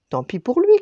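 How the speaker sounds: naughty ducklings teasing; cackling ducklings. Acted. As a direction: quack it out mockingly. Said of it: "cancanent les canetons fripons".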